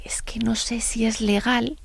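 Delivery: put-on slow, whispered worry from a shy girl; whispering schoolgirl unsure if it's legal; hushed child. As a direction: hush it like a secret. Shy girl doubting aloud.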